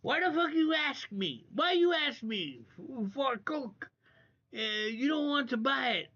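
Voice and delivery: high-pitched mock broken-English voice; broken English accent. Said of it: "trying to say in his broken English".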